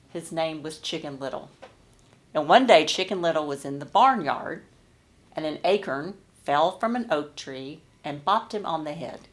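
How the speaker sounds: usual speaking voice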